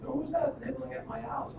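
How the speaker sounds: put-on high, soft old-lady voice; little old lady voice. Old lady calls out to the nibblers, in a little high voice. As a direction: pitch it up small and quiet. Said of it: "a little voice of an old lady".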